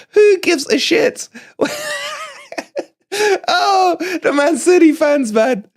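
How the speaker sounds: high squeaky laughing glee; gloating laughter. Real, not acted. Fan laughing at Man City fans after the win.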